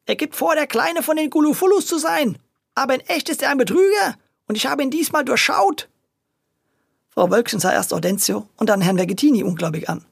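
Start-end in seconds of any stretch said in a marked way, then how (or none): 0.0-5.9 high character voice, self-satisfied; proud of having seen through him; indignant grocer. A narrator's reading. Grocer explaining his suspicion to the officer, high and smug.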